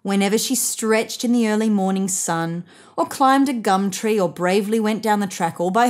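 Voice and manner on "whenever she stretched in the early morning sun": usual speaking voice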